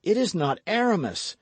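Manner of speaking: high, loud cry with stress on "is". A narrator's reading. D'Artagnan to the stranger, a high loud cry. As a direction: blurt it out, stung, punching "is". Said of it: "cried he".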